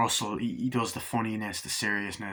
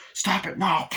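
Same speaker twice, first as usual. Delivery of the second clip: high raised stop; mock yelled stop; 9 semitones higher, 4 dB louder than usual. Man tries to stop the crazed one, high raised voice. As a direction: someone trying to stop a fight. Retold struggle, pleading.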